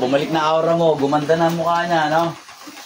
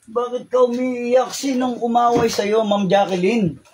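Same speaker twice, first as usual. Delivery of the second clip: slow, higher, soothing question; gentle coaxing; 6 semitones higher, slower than usual. Real, not acted. Healer gently asking the crying woman who hurt her.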